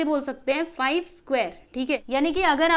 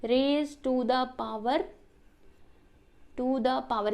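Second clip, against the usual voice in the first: slow demo reading; 2 semitones lower, much slower than usual. Shown as a demonstration. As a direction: raise to the power read very slowly, repeated word by word. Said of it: "how to read".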